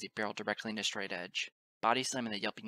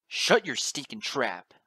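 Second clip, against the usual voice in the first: loud, slow angry order; angry command; 2 semitones higher, 10 dB louder, slower than usual. A narrator's reading. Father silencing his son, loud angry command. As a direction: shout it down, word by heavy word.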